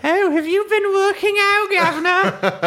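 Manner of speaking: high, laughing Victorian tart voice; Cockney flirt. Acted. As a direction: flirt like a Dickens streetwalker. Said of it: "Dickensian prostitute den".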